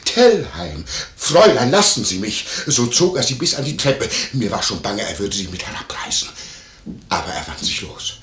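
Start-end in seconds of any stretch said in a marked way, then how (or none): 0.0-2.5 loud re-enacted cries of two people; imitated struggle. Landlord re-enacting the couple's struggle for the maid, excited mimicry. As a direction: act out their tussle, louder. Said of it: "Sie ergriff ihn wieder".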